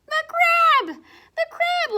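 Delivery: high, loud delighted announcement; excited reveal. Acted. Reading to children, revealing the crab with delight. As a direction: cry out the name like a surprise. Big reveal of the next creature.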